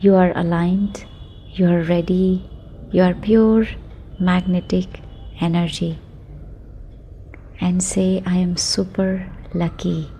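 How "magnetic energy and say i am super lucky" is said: ordinary talk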